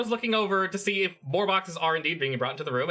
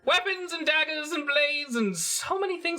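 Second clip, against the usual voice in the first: high, drawn-out theatrical merchant voice; fussy merchant, pitched up and stretched out; Suharto; 11 semitones higher than usual. Acted. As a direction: lament the goods slowly in a high put-on accent. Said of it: "Suharto is, is still like".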